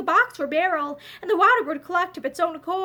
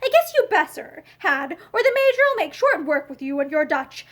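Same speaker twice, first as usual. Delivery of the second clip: usual speaking voice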